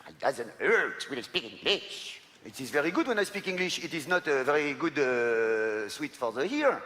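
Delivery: put-on slow, thick French-accented English; clumsy Frenglish; heavy French accent in English. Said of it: "Épouvantable".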